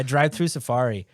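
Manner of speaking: ordinary talk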